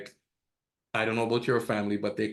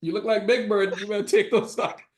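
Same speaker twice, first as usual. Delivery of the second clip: blunt teasing verdict; 8 semitones higher than usual. Retold or imitated. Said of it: "I'm like".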